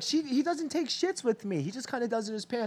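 lightly voiced careless mother; imitating the mom. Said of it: "she's like".

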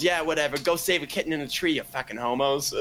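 mock a Boston guy jeering at his rivals; thick Boston accent. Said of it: "In the thickest Boston accent ever".

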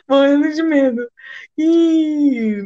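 scared nervous laughter; laughing with fright. Real, not acted. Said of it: "coração palpitando". (rindo de nervoso)

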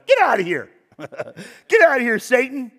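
laughing, tell him to get lost; preacher's amused, fed-up "get out of here"; fed-up dismissal. Retold or imitated. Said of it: "Jesus is so done".

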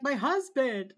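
loud, high mock sob; hammed-up wail of a weeping wife. Host imitating an episode's constantly crying wife, mock wailing. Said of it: "spends the whole episode crying".

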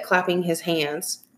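ordinary delivery, nothing marked

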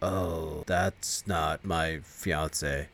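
low, soft, very slow dread; hushed, dragged-out realization of the demon. Retold or imitated. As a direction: whisper it out in slow horror. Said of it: "he's like".

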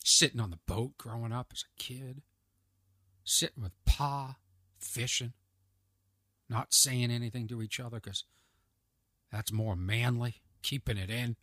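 low, soft, wistful country storyteller; hushed mock-solemn memory of fishing with Pa; nostalgic country reminiscer. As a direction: reminisce quietly, pitched well down. Resumes nostalgic reminiscence over fiddle.

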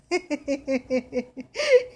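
short real laugh rising. Real, not acted. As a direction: laugh it off briefly. Laughs at the saying. (risa breve y espontánea)

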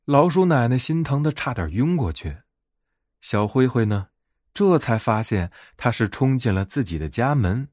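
ordinary delivery, nothing marked

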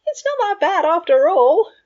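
high, loud blurt; surprised at himself. A narrator's reading. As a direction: blurt it out in spite of yourself. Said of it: "surprising himself that those words had come out".

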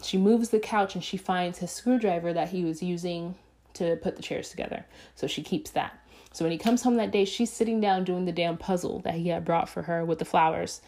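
ordinary delivery

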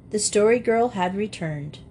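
ordinary delivery

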